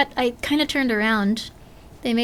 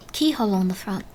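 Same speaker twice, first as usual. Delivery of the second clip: ordinary talk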